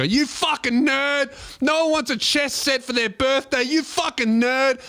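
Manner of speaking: bully sneering at a nerd, overblown; mock bully yell. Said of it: "giving him a swirly and go".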